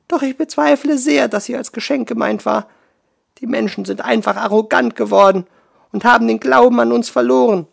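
high, loud, furious water spirit; angry. Acted. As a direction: rant at the forgetful humans. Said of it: "Nun wurde auch sie wütend".

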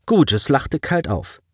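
ordinary delivery

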